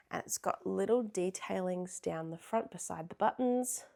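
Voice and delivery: usual speaking voice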